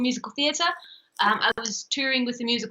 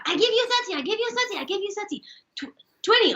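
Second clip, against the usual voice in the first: high-pitched Chinese-accented market haggler; 9 semitones higher, faster than usual. Shown as a demonstration. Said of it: "Chinese. You gotta do Chinese".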